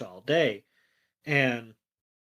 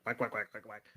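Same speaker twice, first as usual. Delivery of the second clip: quick, soft run of quacks; duck quacking; 2 semitones lower, 10 dB quieter than usual. Retold or imitated. Ducklets flying in place, imitated quacking.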